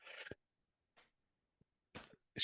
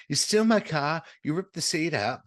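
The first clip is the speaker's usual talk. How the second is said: Cindy's rant; 9 semitones higher, 5 dB louder than usual. Retold or imitated. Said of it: "So she goes".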